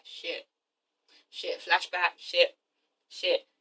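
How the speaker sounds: very low, hushed cursing to himself; muttered realisation. Retold or imitated. Terrence having a flashback to Renee, muttered realisation. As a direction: let each word drop out under the breath, spaced apart.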